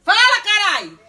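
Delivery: a loud exploding yell; furious shout. Real, not acted. Mom yells at her silent son to speak. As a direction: blow up at him to make him talk.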